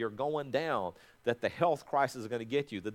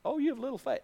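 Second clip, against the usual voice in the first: 1 higher rebuke; 9 semitones higher, faster than usual. Acted. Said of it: "Jesus stands up and said".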